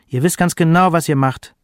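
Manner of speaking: insistent, lightly angry accusation; sharp tone. A narrator's reading. Mother accuses her sons of knowing better, sharp tone. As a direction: press the accusation home sharply. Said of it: "der Ton wurde scharf".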